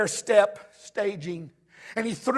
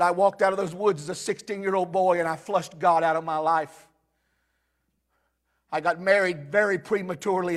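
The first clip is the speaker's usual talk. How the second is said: normal talking voice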